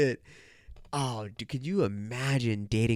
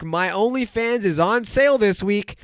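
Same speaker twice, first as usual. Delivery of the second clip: higher, louder mock sales pitch; mocking promo announcement, pitched up; imitated OnlyFans girl; 7 semitones higher, 6 dB louder than usual. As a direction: lightly voice a girl plugging her page. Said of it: "those same girls are like".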